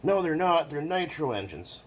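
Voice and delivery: high snooty know-it-all; mock pedant. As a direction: play the smug corrector. Mocking a commenter.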